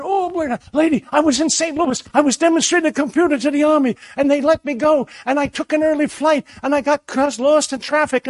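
high, hurried excuses tumbling out; rushed pleading. Retold or imitated. A stranger pleading with the old lady to let him in, high and rushed. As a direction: babble your excuses in a rush, pitched high.

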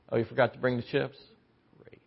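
soft, fast sarcastic mutter; grumbling sarcasm under the breath; sarcastic grumble. Retold or imitated. A friend mocking a forgotten errand, soft sarcastic grumble. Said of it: "It goes like this".

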